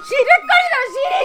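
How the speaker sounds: furious shout; angry demand. Acted. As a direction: bark the question at him. Mother demands where daughter-in-law is.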